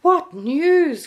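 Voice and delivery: high, loud joyful exclamation; joyful cry. A narrator's reading. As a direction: cry it out with joy. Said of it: "cried Santa joyfully".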